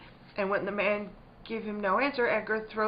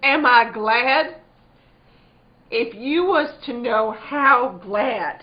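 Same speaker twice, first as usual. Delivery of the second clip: louder and slow, overflowing; fervent; 2 semitones higher, 11 dB louder, slower than usual. Acted. Wife asked if she's glad, fervent and slow. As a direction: let the feeling burst out slowly.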